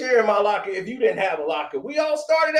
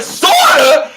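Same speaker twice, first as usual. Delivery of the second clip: high, loud incredulous echo; retelling his shocked echo of the girl's answer; stunned disbelief; 6 semitones higher, 9 dB louder than usual. Retold or imitated. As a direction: throw her word back in shock.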